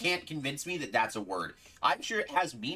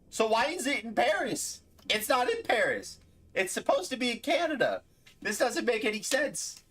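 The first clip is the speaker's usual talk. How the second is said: ordinary talk, nothing marked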